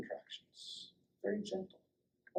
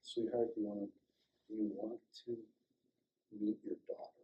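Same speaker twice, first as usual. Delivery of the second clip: tender question; 3 semitones lower, 8 dB quieter, faster than usual. Retold or imitated. Offering the newborn to mother.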